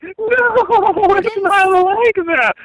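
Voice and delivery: high, slow, coy denial; mocking denial. Caller mimics her denying the crush, high slow coy voice. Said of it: "You were like".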